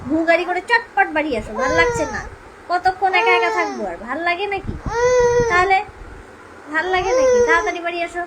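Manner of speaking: put-on high, loud babyish pleading; baby voice to dad. Mother voicing baby, begging father to come home. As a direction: plead like the little one. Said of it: "বলতো".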